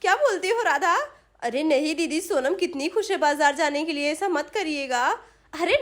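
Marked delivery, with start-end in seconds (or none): none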